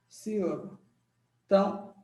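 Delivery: normal talking voice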